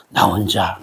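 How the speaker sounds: low, tearful murmur; choked-up lonely. Acted. Paul alone three years in desert, low tearful murmur. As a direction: let the loneliness crack the voice.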